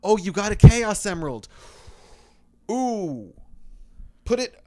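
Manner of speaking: high, loud gushing; mock excited. Cartoon hero gushing over a found Chaos Emerald. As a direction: gush over it like a cartoon sidekick. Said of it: "Why is it never like".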